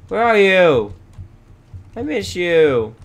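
high, loud searching call; plaintive calling. Pretend. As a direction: call out longingly for the lost cow. Searches for missing cow.

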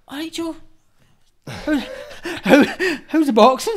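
high mimicked hello, broken by laughter; imitated friendly greeting. Masked knifeman greets the boxer, high imitated small talk. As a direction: put on a high, casual voice.

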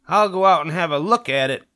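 put-on high, loud, defiant little girl; grudging child. Daughter agrees to look at the new neighborhood, grudging. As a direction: give in loudly, under protest.

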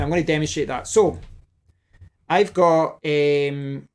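ordinary talk

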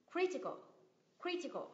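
CRI in "Critical" pitched high, then falling away; "critical" as CRI-ti-cal, first syllable stressed. Shown as a demonstration. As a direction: hit CRI in "critical", let the rest drop. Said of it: "cái cách nhấn âm trong những cái từ này".

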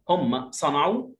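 ordinary talk

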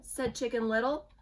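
plain reading voice

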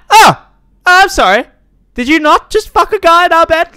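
loud, high-pitched indignant squeal; mock outrage. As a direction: shriek it as a hammed-up accusation. Mock quarrel with game wife.

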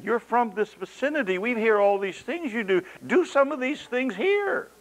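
high, pushy entitled tone; demanding crowd. Retold or imitated. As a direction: lean on him as hometown folk. Paraphrasing demanding hometown crowd.

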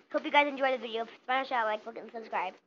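usual speaking voice

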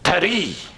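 "Paris" said French-style as pa-REE; French pronunciation. Shown as a demonstration. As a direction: drop the final s in "Paris": pa-REE. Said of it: "that's how they say it".

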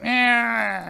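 put-on high, loud, long drunken bleat; drunken Paul. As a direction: imitate an old drunkard who can't form words. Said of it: "can't really talk".

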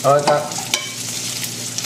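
whispered under the breath. Real, not acted. Cook murmuring to himself over the pan, whispered. Showing the browning, aside.

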